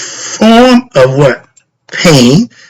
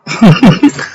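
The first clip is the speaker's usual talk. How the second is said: short laugh; 7 semitones higher than usual. Real, not acted. Laughs at having no friends.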